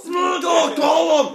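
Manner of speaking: "Smeagol, Gollum!" shouted high with a laugh; relieved eureka yell; excited shout. Real, not acted. Guest shouts the character name he finally remembers, excited.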